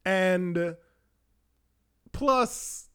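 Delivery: ordinary delivery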